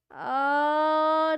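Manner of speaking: long loud "aa" stretched out; loud sustained exclamation; loud drawn aah. Real, not acted. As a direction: hold a loud open aah. Loud drawn vocal sound.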